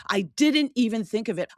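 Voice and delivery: exasperated at herself after the husband's remark; exasperated aloud. Shown as a demonstration. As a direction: say it out loud at herself, frustrated. Repeated aloud after whisper.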